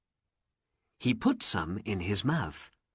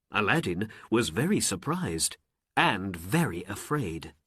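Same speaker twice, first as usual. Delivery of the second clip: ordinary delivery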